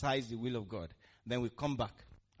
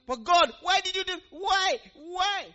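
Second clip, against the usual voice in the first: high, loud, pleading mock whine; a hammed-up, far higher cry of why; whining complaint to God; 12 dB louder, slower than usual. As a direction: play the sinner wailing at God why. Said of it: "you can't come back and say".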